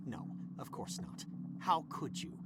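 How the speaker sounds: a muttered put-down, almost voiceless; sarcastic whispered aside. Acted. Boss whispers a scornful aside at the assistant. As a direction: whisper it under your breath, scornful.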